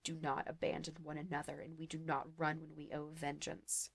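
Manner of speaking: hissed. Acted. Locke vows vengeance to the sorcerer in a soft hiss. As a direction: keep hissing the vow through pain. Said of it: "hissed Locke".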